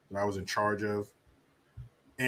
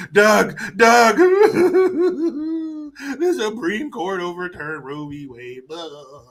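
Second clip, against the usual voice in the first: higher, louder, laughing mock call; mock Kamala; 9 semitones higher, 8 dB louder, slower than usual. Mocking Kamala phoning Doug, higher laughing parody. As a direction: ham up a breathless call to her husband, cracking up.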